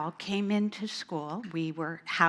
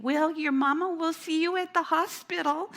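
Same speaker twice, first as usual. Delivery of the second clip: calling out; 9 semitones higher, 3 dB louder than usual. Retold or imitated. Waving goodbye as ambulance leaves.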